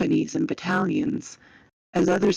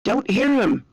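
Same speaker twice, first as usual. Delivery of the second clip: higher-pitched answering shout; raised voice, moderately loud soldier's reply; yelling back; 5 semitones higher, 4 dB louder than usual. A narrator's reading. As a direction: call back to your mate over the noise. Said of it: "Tom yelled back".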